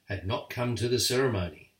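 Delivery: plain reading voice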